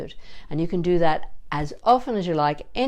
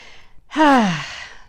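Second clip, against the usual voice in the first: a long heavy sigh, audible breath; weary sigh; 4 semitones higher, 5 dB louder than usual. Real, not acted. Teacher sighing about lockdown, weary sigh. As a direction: let out a tired sigh at it all.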